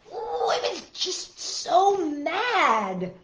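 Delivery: high, slow, stretched mock anger; storybook grumpy. A narrator's reading. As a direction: whine out a hammed-up childish grievance. Book child grumbles in anger.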